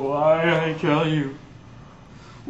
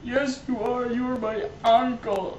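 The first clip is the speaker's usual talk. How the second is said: higher, softer voice of disbelief; emotional recognition; 6 semitones higher, 5 dB quieter than usual. Acted. Nephew realising the villain is his uncle, higher pitched. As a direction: plead it, voice lifted in shock.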